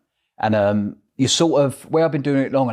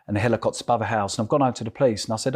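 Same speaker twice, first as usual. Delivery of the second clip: ordinary delivery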